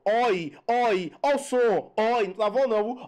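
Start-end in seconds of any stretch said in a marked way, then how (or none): 0.0-1.1 "oi" with open o, falling; showing the sound. Shown as a demonstration. Teacher demonstrating the sound in herói, open "oi". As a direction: drop each "oi" with a wide open o.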